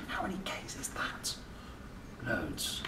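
breathy whispered question; hushed whisper to a child. Acted. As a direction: lean in and whisper it.

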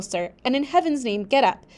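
steady read-aloud narration of a gothic novel; story narration. A narrator's reading. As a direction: read it like a tale for the class. Reads novel aloud to class.

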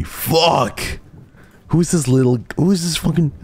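high-pitched excited outburst; helpless squealing adoration; overwhelmed gushing. Real, not acted. Gushing over tiny pet.

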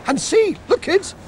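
comic character voice. Acted. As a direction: bounce through the silly words like a cartoon. Comic character greeting the kids.

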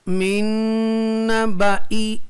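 मिन नबाई with a long held n; recitation demo. Shown as a demonstration. Teacher shows students the reading, held hum in मिन नबाई. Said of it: "ज़ैद अलिफ को नहीं पढ़ेंगे".

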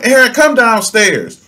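high, loud call up the stairs; mock mom calling out loud. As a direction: mom yelling for her son upstairs. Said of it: "So she called for him".

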